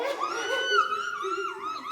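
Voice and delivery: high, wild villain cackle; maniacal laugh. Acted. Mad villain gloats over the will with a wild cackle. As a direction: cackle like a madman.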